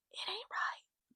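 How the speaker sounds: breathy hushed whisper, slowed down; whispered because it still hurts. Real, not acted. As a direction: hush it like you might cry. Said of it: "Guys, I'm whispering because it ain't right".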